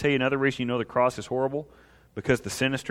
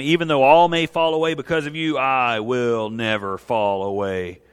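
bold vow; 6 dB louder than usual. Acted. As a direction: declare it firmly, dragging out the last words. Peter's bold vow acted in reading.

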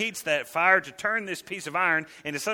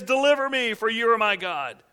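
a loud, higher plea to the idol; pleading prayer; 5 semitones higher, 4 dB louder than usual. Acted. Preacher voicing the idolater, praying loudly to his idol. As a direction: cry out to the block of wood for rescue.

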